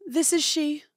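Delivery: put-on mom phone voice. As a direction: answer grandly like a mom taking a formal call. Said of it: "Do you know what moms love to do?".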